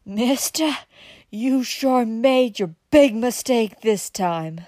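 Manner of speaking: slow, loud, high-pitched menace; menacing threat. A narrator's reading. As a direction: spit the warning slowly. Outlaw turns menacing.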